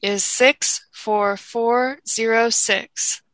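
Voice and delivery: slow, even number dictation; slow school dictation. Shown as a demonstration. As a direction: read each digit slowly for notes. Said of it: "I will read it twice".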